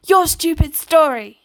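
loud, high, accusing outburst; angry shout. A narrator's reading. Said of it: "he shouted in his heavy Russian accent".

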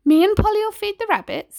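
high, bright soothing mum; cheerful motherly. Acted. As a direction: settle it brightly. Mother settles dispute.

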